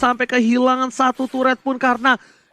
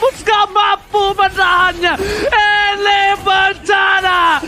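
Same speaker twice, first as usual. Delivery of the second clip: drawn-out shouted call, higher; slow hype shout; 4 semitones higher, slower than usual. Real, not acted. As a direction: shout it like a caster at the climax. Caster shouting climax.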